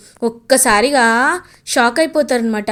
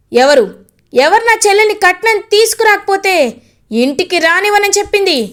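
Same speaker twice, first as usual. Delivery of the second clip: loud, higher angry challenge; furious demand; 7 semitones higher, 4 dB louder than usual. Acted. Brother confronting his sister's in-laws over dowry, furious shout.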